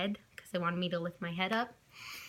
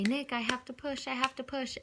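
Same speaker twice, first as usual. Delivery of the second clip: urgent plea; 6 semitones higher than usual. Retold or imitated. Waking sleeping husband mid-labor.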